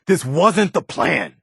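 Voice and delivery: slow, high, strained protest; dismayed. Acted. Preston reproaching the General, slow dismayed protest. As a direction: drag it out in disbelief.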